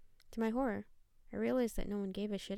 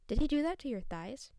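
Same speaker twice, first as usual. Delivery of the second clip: sudden louder, higher blurt; 5 semitones higher, 7 dB louder than usual. A narrator's reading. Narrator blurting a question at Sandy, sudden and loud. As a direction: burst out with it before you can stop. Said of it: "before blurting out".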